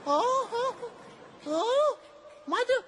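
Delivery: put-on squeaky high child voice, aggrieved; high whining child. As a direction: whine like a hurt little boy. Child whining over mother's box.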